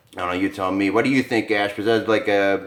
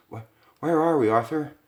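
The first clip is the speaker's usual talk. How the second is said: higher, stammering wife; scared stammer; 7 semitones higher than usual. Acted. Wife to Arthur in the dark, higher frightened stammer. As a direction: stumble over the first word in fear.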